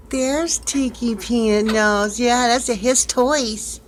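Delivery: high, sweet voice cooing at a pet. Real, not acted. Owner calling to her parrot, high cooing baby talk. Praising bird in cage.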